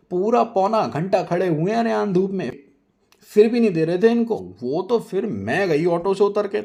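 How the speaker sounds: high, loud showing-off voice; haughty boasting. Acted. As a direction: brag grandly about the sweets. Nazia boasting to hostess.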